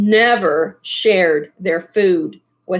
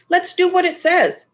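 Papa agreeing; 8 semitones higher, faster than usual. Acted.

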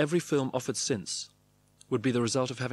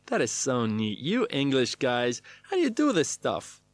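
impressed producer. A narrator's reading. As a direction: gush over the visiting actor. Impressed producers voiced to English actor.

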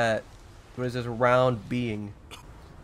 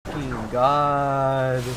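drawn-out groan; much slower than usual. Real, not acted. Exasperated at the boss.

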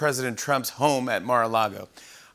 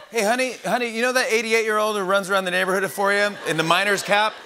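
high, chatty neighbor voice; surprised neighbor telling spouse; gossiping neighbor; 6 semitones higher than usual. Retold or imitated.

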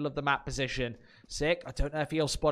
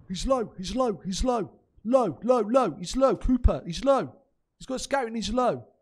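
Smooya impression; 5 semitones higher than usual. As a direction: call it out like a teammate on comms. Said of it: "That was Smooya".

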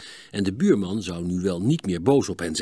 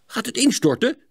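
startled; 13 semitones higher, 4 dB louder than usual. A narrator's reading. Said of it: "schrok Cor".

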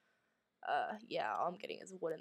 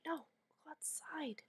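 slow, whispered urging; urgent whisper; 8 semitones higher, 4 dB quieter than usual. Real, not acted. Player urges game character outside, whispered. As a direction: plead under your breath.

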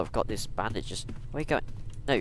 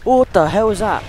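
startled yell; 6 semitones higher, 13 dB louder than usual. Real, not acted. Monster appears.

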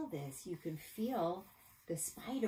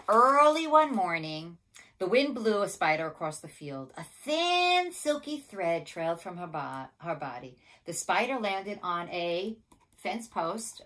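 picture-book narration; 2 semitones higher, 10 dB louder than usual. A narrator's reading. Teacher reading a spider story to children, animated narration. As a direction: read it big and lively for little listeners.

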